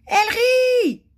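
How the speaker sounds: very high, loud shriek of outrage; scandalised howl. A narrator's reading. King catches a visitor laughing, scandalised howl. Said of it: "hurla le roi d'un ton scandalisé".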